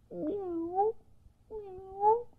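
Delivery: high, soft sleepy mews; kitty meow. Acted. A sleepy kitten mewing as it follows to bed. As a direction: mew softly like a tired kitten. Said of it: "The little kitty says".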